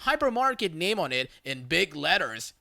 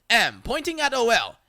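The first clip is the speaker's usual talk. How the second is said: ordinary delivery, nothing marked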